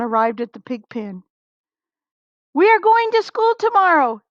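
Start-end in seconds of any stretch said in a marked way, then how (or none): 2.5-4.2 high, quick, eager farmer voice; Farmer Brown announcing. A narrator's reading. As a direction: announce the news brightly as the farmer. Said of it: "he announced".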